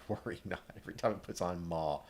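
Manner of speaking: ordinary talk, nothing marked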